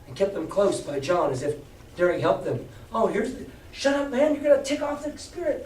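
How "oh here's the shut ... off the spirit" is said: higher, anxious hush-up; nervous shushing pirate. Acted.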